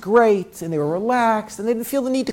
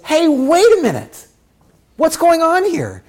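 higher and louder, "wait a minute"; objecting rabbi; 10 semitones higher, 4 dB louder than usual. Acted. Rabbi steps in to question the idea, higher and louder. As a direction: cut in with a challenge.

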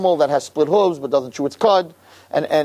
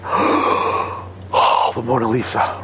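awed hush; 6 semitones lower, much slower than usual. Retold or imitated. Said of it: "And everyone's like".

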